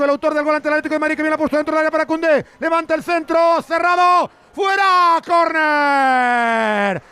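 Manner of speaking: building from fast and lively to a high shouted call; rising to a full shout as the cross comes in; excited play-by-play. Real, not acted. Live play-by-play of corner chance. (narración que sube hasta gritar)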